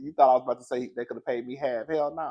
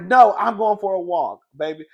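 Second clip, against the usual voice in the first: mock loud retort; 5 semitones higher, 11 dB louder than usual. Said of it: "She was like".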